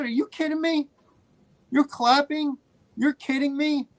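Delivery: retold outburst, pitched high, incredulous; indignant. Moviegoer speaking up to clapping women, indignant. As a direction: confront them in disbelief, voice pushed up high. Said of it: "I was getting louder and more serious".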